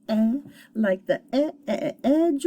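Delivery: "eh" isolated and repeated, then "edge"; high, stuttered "eh, eh" leading into "edge"; short vowel sound. Shown as a demonstration. Teacher shows the vowel of a closed syllable, short e. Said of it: "say its short vowel sound".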